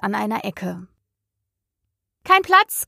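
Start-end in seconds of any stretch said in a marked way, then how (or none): 2.2-2.9 high, loud cry of no room. A narrator's reading. Hare and hatter call out to Alice, a high loud cry. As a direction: fling it out high and loud to shoo her off. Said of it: "riefen sie aus".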